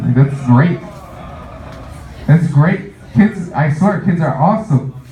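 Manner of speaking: usual speaking voice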